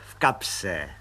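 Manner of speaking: low, grinning through bared teeth; toothy grin. A narrator's reading. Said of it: "vycenil zuby".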